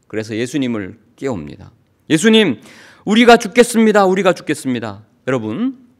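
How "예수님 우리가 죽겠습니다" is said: loud, higher desperate cry for help; panicked cry. Acted. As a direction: cry out as if the boat is sinking. Disciples cry out in storm.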